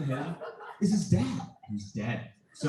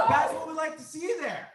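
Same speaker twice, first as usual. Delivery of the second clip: higher, laughing cry on "That's"; laughing exclaim; 9 semitones higher than usual. Real, not acted. Student to class praising Thorin, laughing shout. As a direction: whoop it out through laughter.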